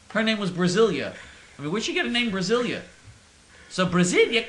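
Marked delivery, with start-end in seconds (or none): none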